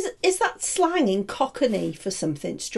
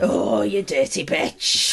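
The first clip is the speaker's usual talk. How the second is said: low, slow put-on leer; mock lecherous man; 8 semitones lower, slower than usual. Teasing co-host about his Cheryl fantasy, mock lecherous. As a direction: draw it out low, hammed-up lust.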